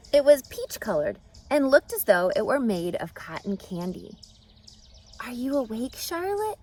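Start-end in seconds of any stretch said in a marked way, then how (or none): none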